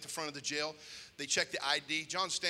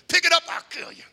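high, loud, berating yell; furious threat; 10 semitones higher, 10 dB louder than usual. Retold or imitated. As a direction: chew out the shooter and threaten him. Palaga threatens and berates gunman.

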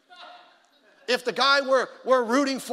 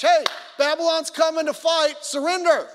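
loud, high-pitched proclamation; prophet preaching; 7 semitones higher, 8 dB louder than usual. Acted. Jeremiah warning that Babylon is coming, prophet preaching. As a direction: cry out the warning to the whole city. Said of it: "when he was preaching the message".

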